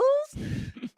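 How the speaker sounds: shrill little society titter; high tittering laugh. Acted. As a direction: titter like a delighted hostess. Mrs Inglethorpe laughs in character.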